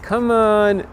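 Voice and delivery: put-on high, whiny kid complaint; complaining kid. Kid stuck shoveling snow, complaining in a high whine. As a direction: whine like a bored ten-year-old. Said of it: "complaining that I can't get to work".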